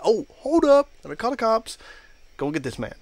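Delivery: mock alarm. Coworker jokes about calling the cops, mock alarm. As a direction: pretend you're urgently halting everything.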